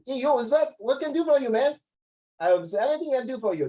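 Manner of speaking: high, brash mock American doctor; hammed-up American greeting; American accent. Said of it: "If American doctor will be like".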